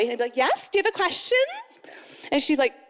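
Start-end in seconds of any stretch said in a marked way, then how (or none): 0.4-1.4 high, pert answer to mom; imitating a sassy kid, pitched up; cheeky child reply. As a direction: answer back with mock innocence. Acted cheeky child answering mother.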